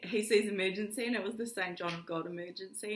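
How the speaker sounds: ordinary talk, nothing marked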